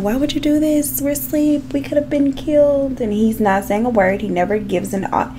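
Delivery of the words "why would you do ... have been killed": higher mock wife voice, pleading; a hint of an upset wife's voice; voicing the wife. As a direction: play the scared wife, pitched up. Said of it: "she's asking him".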